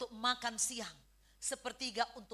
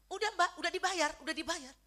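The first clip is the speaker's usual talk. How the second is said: quick, raised conductor's call; louder, higher, hurried mock conductor; acted bus conductor; 6 semitones higher, 6 dB louder, faster than usual. Conductor calls out in retold scene.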